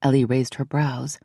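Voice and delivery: plain reading voice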